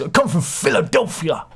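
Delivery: high, put-on Philly accent. Host attempting someone's Philly accent for viewers, hammed-up Philly accent. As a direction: do a joke Philadelphia accent, pitched right up. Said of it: "he does a Philly accent".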